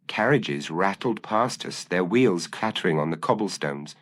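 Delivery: ordinary delivery, nothing marked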